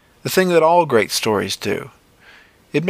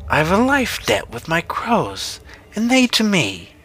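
high, slow witch voice; 8 semitones higher than usual. A narrator's reading. Witch declaring her bond with her crows, high witch voice. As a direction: stretch and lift the words like a witch.

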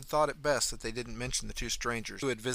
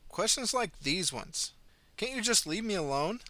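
exasperated; 9 semitones higher than usual. Acted. Exasperated mother pushes back.

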